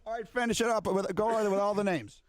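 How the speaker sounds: ordinary talk, nothing marked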